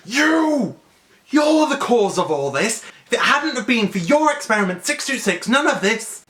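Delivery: high, loud furious rebuke; yelling councilwoman. Retold or imitated. Councilwoman yelling at the scientist, high and furious. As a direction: blast the scientist with blame. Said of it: "is yelling at Jumba".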